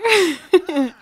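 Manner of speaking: high, loud burst of laughter; excited laugh. Real, not acted. Vlogger thrilled by guests, loud laugh.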